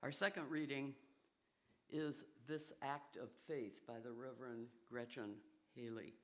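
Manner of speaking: ordinary talk, nothing marked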